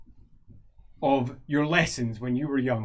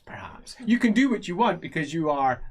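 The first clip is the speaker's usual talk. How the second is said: ordinary talk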